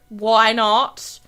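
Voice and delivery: ordinary talk, nothing marked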